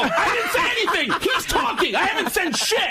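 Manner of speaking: high, frantic protest through laughs; panicked denial. Retold or imitated. Denying to the angry husband, a frantic high protest. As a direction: squeal it out defensively.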